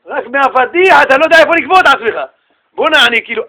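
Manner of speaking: ordinary talk, nothing marked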